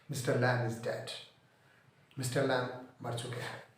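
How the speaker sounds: understated grief while retelling; subdued sorrow. Retold or imitated. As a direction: let it sink quietly, as in mourning. Teacher retells the friend's death.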